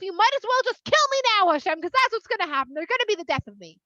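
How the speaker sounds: mildly hammed-up despair; overwhelmed.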